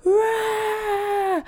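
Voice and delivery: high, sustained mock baby wail; imitated baby scream. Baby screaming while mother argues on the phone, mock scream. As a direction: shriek like a baby, without extra volume.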